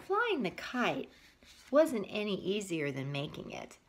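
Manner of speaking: put-on reading a picture book aloud to kids; story narration. A narrator's reading. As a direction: tell it gently to little listeners.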